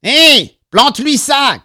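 high, loud cry across the bar; urgent shout. A narrator's reading. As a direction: yell a command in a panic fight. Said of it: "cria Jack Paul".

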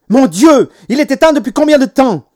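high, loud, alarmed mother; authoritative demand. A narrator's reading. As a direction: demand an answer, loudly and high. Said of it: "demanda maman avec autorité".

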